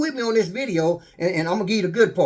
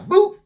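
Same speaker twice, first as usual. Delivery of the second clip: mock boop; 12 semitones higher, 6 dB louder than usual. Sound effect of surprise.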